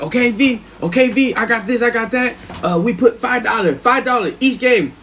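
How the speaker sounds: high-pitched eager betting voice; Capone. Retold or imitated. Neighbor laying bets with the cellmate, high voice. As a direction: rattle off the bets, pitched well up. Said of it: "Capone be like".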